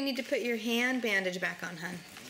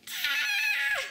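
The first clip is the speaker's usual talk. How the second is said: a sudden high squeal of mock alarm; high mock gasp "Ah". Mother squeals in mock alarm at the crawling baby. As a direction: squeal like you just caught him.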